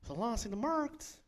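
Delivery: high, lilting Leiden dialect; exaggerated Leiden singsong; singsong Leiden accent. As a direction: sing it out like a caricature Leidenaar. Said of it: "Dat het Leidse accent is van".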